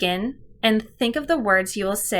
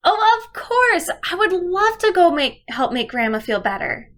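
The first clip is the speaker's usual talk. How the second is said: put-on high, loud eager girl voice; eager child; 9 semitones higher, 4 dB louder than usual. Shown as a demonstration. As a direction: play the excited little girl. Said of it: "here's an example of what I might do".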